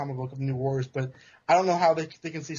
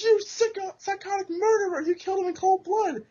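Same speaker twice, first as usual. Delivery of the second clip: much higher, hammed-up hero's outraged cry; outraged accusation. Scar Spider yells at the Jackal, high hammed-up outrage. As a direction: accuse him at the top of the voice.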